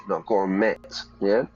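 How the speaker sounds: normal talking voice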